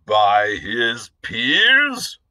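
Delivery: "By his peers?" slow, rising, startled; taken aback. Acted. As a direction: repeat "By his peers?" slowly in surprise. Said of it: "taken aback by these three words".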